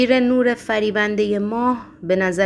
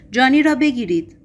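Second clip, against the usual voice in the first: loud, higher narrated shout; 4 semitones higher, 6 dB louder than usual. A narrator's reading. Bewildered Ivan giving in, calling to catch the criminal, shouting. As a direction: raise the alarm with a contained shout. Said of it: "تسلیم شد و فریاد زد".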